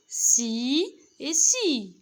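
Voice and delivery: si et si drawn out slowly, each si crisp; slow model syllables. Shown as a demonstration. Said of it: "ننطقهم".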